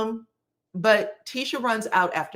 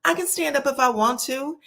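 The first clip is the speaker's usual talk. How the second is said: lightly imitated child, higher pitch; childlike protest; 7 semitones higher than usual. [name] answering Nell like a child, mock childish protest. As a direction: talk back like a little kid. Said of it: "talking like a child".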